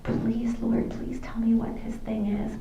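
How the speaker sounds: urgent slow whispered plea; whispered prayer. Retold or imitated. Praying silently to the Lord while talking, whispered. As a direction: whisper it to God so he won't hear.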